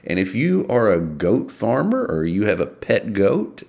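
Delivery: ordinary delivery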